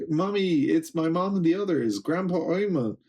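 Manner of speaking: high-pitched imitation of excited children; kids calling family. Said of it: "so they're like".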